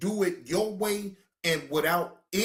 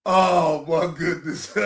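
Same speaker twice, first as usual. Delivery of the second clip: laughing; slower than usual. Real, not acted. Laughing at the dog.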